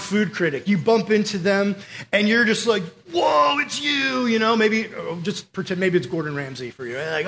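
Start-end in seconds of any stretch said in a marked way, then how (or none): 3.1-4.3 high, long drawn-out awe; starstruck gush. Acted. Fan bumps into a world-renowned food critic, starstruck.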